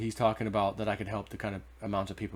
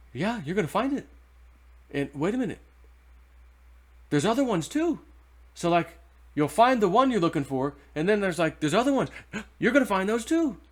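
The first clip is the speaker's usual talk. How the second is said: much higher and louder, tumbling with delight; excited; 9 semitones higher, 7 dB louder than usual. Retold or imitated. Old man revealing more gold up there, excited. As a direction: gush about the treasures still waiting. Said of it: "kind of excited for me".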